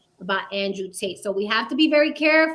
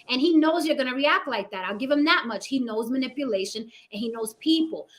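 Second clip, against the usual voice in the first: ordinary delivery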